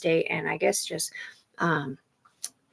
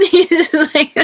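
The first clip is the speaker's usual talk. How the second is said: high, loud laughter through the words; loud, high, laughing fit; laughing hard; 11 semitones higher, 12 dB louder, slower than usual. Real, not acted. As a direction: crack up while trying to speak. Bursts out laughing.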